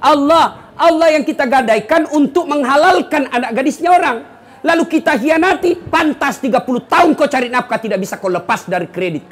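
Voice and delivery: high, loud preaching; fiery preaching. Acted. Preacher rousing the congregation in a high, loud preaching voice. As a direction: preach it out to the crowd. Preacher rises to full force.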